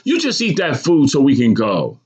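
high-voiced mother's scolding; yelled order. A narrator's reading. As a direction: order the child to obey, pitched high. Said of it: "my mother yelled".

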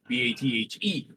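ordinary delivery

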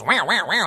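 wavering "wah" noise swooping up and down; imitated noisemaker. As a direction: mimic a comic spinning noisemaker. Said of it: "spins some things".

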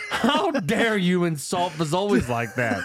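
slow, hammed-up indignation through a laugh; mock-indignant and slow, laughing; mock outrage. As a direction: drag it out, playfully offended. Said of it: "I said".

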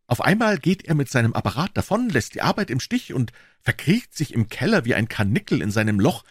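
Wilson's voice. A narrator's reading. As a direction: pitch him up as the earnest tradesman.

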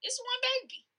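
high and incredulous; mock outrage. Real, not acted. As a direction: squeal it in disbelief. Mock outrage at plural babies.

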